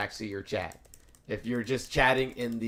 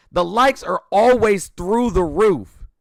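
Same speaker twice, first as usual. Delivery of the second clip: slow, loud, exasperated; emphatic rant; 9 semitones higher, 12 dB louder, slower than usual. Real, not acted. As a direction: drag out each word in frustration. Frustrated rant about likes.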